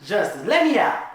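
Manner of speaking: loud, high, angry order; fuming demand. Acted. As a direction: demand to be let out. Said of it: "she fuming now".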